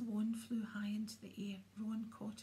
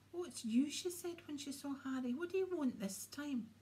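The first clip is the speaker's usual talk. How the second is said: lightly voiced Moaning Myrtle; 5 semitones higher, 4 dB louder than usual. Moaning Myrtle's peevish greeting.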